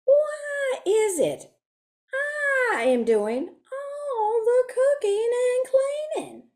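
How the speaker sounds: high, slow put-upon whine; a hint of a resentful sister grumbling; complaining. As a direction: whine it to yourself, aggrieved. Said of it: "started saying to herself".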